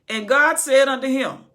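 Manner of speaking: loud sermon reading; booming pulpit reading; preaching scripture. Acted. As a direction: proclaim the verse. Reading scripture at full force.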